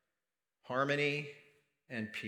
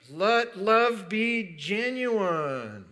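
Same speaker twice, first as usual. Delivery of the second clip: very slow, higher and louder; emphatic drawn-out declaration; 7 semitones higher, 6 dB louder, much slower than usual. Real, not acted. Preacher restating his main verse, slow emphatic declaration. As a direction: stretch every word out, raised and ringing.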